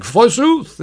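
high, theatrical Shakespearean thespian; hammed-up Elizabethan flourish, pitched high; Leonard. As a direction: declaim it like a ham stage actor. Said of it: "considers himself a thespian".

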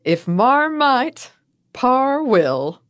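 slow, high, drawn-out ad-slogan voice; high, slow announcer flourish; grand slogan read. Pretend. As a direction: sell the pun like a proud old radio ad. Reading out the pun slogan.

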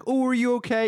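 high, fussy mock concern; concerned bystander. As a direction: imitate an over-solicitous onlooker. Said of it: "and someone's like".

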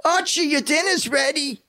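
very high, drawn-out sitcom wife impression; Edith. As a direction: call your husband to dinner in a high screechy voice. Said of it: "let's hear your Edith, uh, imitation".

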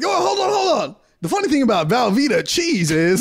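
high, urgent comic call; mock calling out. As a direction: call after a driver about to leave. Acted calling to departing delivery driver.

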